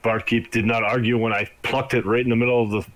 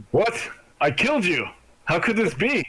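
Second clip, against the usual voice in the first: rising panicked npc voice, higher; spooked npc; 5 semitones higher than usual. Acted. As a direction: hunter suddenly terrified, voice climbing. NPC freaks out at heartbeat.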